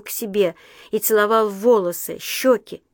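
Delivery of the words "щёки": ordinary delivery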